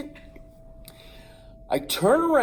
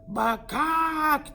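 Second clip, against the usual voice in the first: much higher squawking bakak; rooster call; 11 semitones higher than usual. Retold or imitated. The rooster dares the boy, squawking much higher. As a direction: crow it like a cocky rooster.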